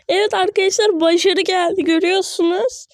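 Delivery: telling viewers it worked; triumphant. Real, not acted. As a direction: announce the win proudly. Celebrates success to viewers.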